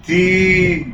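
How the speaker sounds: تي with the ee vowel held long; wrongly lengthened vowel. Shown as a demonstration. Teacher showing pupils what not to say, تي stretched long. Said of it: "ما اقولش".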